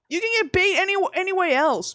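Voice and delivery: high-pitched incredulous protest; re-enacted pleading objection; exasperated plea. Pleading with snake feeder.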